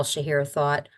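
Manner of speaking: ordinary delivery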